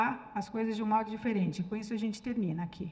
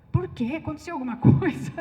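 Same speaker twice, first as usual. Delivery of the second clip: louder, higher suspicious questioning; mock-baffled question, laughter close behind; puzzled husband; 3 semitones higher, 8 dB louder than usual. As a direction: imitate a husband caught off guard. Acting her puzzled husband's reply.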